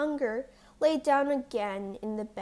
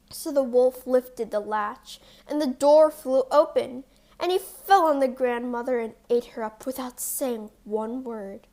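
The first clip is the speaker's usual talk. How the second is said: plain reading voice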